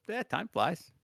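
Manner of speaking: put-on high, creaky old man voice. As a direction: say it like a doddering grandpa. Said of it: "my go-to old man voice".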